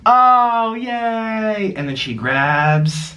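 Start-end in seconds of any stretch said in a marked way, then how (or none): 0.0-1.7 high delighted cheer; excited. Real, not acted. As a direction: cheer for the pet. Sloth grabs the lettuce.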